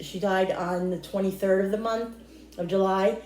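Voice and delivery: ordinary talk, nothing marked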